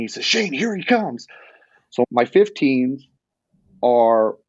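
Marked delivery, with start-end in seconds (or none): 0.3-1.3 high-pitched mock call across the hill; retold yell. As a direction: friend calling out that the deer is coming, mock yell. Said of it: "And Todd yells at me".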